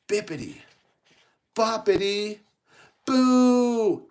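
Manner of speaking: theatrical fairy godmother incantation; spell casting. Acted. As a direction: build to a long, high, loud final word.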